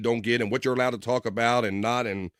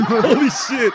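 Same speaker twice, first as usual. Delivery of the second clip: laughing exclamation; 9 semitones higher, 5 dB louder, much slower than usual. Real, not acted. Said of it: "laughing reaction".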